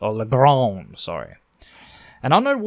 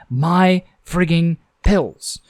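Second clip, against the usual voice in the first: slow, drawn-out yell, higher and louder; 5 semitones higher, 5 dB louder, much slower than usual. Acted. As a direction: stretch it out in exasperation. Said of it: "Her yelling".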